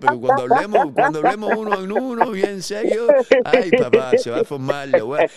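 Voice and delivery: high, loud fired-up teasing; excited hype. Real, not acted. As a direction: hype up the showdown. Hypes a future fight.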